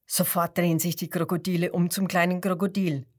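ordinary delivery, nothing marked

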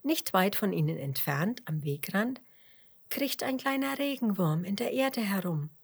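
ordinary delivery, nothing marked

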